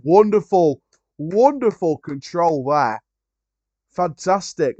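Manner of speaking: loud, rising delight at the touch; slow, savouring commentary praise; excited praise. Real, not acted. Commentating on the Birmingham player's control, excited and loud.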